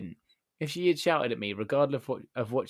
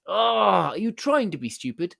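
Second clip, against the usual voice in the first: mock angry, slow and louder, pitched up; exasperated snap; 8 semitones higher, 8 dB louder, slower than usual. Rude customer scolding a non-employee, slow angry sneer. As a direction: sneer it at him like he's dim.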